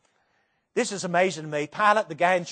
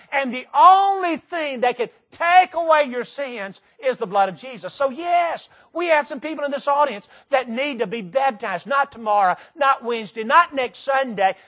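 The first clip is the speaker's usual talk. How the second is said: raised, urgent sermon climax; preaching at full force; 6 semitones higher, 5 dB louder than usual. Real, not acted. Preacher urging the unbaptized in the audience to act now, full-force preaching. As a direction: drive the appeal home, high and emphatic.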